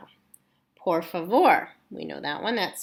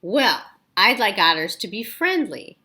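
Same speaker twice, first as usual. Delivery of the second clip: ordinary delivery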